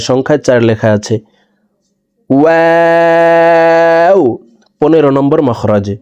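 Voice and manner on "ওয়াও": "ওয়াও" with round lips, held very long on a high level tone; round-lipped, stretched waw. Shown as a demonstration. Teacher demonstrating waw, long round-lipped hold. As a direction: draw out "ওয়াও" as one steady chanted note. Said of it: "চার আলিফ টেনে পড়তে হবে".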